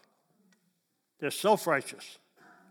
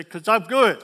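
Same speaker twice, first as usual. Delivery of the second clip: proud claim; 3 semitones higher, 10 dB louder than usual. Retold or imitated. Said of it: "'Cause they say".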